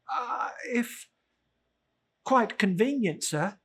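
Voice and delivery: high, nervous plea; timid clerk. Acted. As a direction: ask meekly. Bob Cratchit timid.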